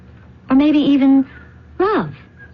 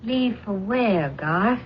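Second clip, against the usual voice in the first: quiet challenge; 8 dB quieter, slower than usual. Acted. Pat catches him leaving.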